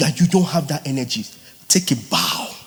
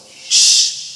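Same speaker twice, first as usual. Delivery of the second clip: hot pan sizzle imitated in breath; sizzling hiss. Shown as a demonstration. Coach showing students the hot pan sizzle, an unvoiced hiss. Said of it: "what happens?".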